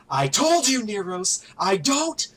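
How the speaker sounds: high, loud enraged shout; slow, punched-out angry roar; furious yell. Acted. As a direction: yell it at an underling, stretching the last words. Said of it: "as he just yells".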